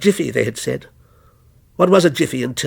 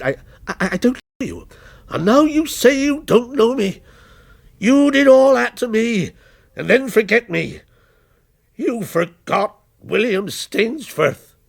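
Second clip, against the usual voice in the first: rising accusation; 7 semitones higher than usual. A narrator's reading. The wronged creation confronting the writer, bitter. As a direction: hurl the blame back at him.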